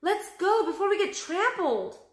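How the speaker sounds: high, loud urgent plea; urgent cry. A narrator's reading. Jack urging Annie away from the herd. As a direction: hurry her along in fear. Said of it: "he cried".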